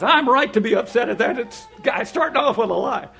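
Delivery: high, loud self-righteous protest; hammed-up aggrieved outburst; mock indignation. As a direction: insist hotly that you are justified. Acts out his self-justifying indignation.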